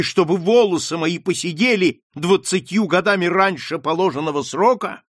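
unstoppable outburst. Acted. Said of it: "не умолкала Маргарита".

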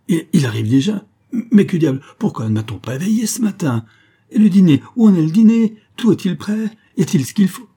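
loud, high, flustered outburst; panicked questioning. Acted. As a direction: fire off questions in rising alarm. Husband alarmed at guests' early arrival.

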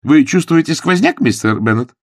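high, loud exclamation; exclaimed question. A narrator's reading. Jane questions sneezing Mr Bennett, exclaiming. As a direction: call the question out sharply. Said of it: "воскликнула Джен".

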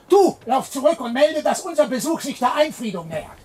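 calling out an order. Acted. Cellarer sends servant off.

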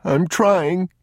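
put-on high child's wail; tearful. Crying boy tries to obey his father, tearful. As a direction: protest through tears, high-pitched.

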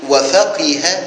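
وفقه said "wa-faqiha", with i on the middle qaf; middle vowel i. Shown as a demonstration. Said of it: "وكسر العين".

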